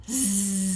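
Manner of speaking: sharp inhale, then buzzing; gasp then bee buzz. Shown as a demonstration. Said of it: "we can pretend we're the buzzy bee".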